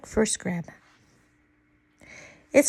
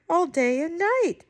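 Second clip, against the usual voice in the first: ordinary delivery, nothing marked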